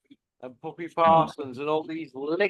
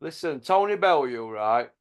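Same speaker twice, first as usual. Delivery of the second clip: normal talking voice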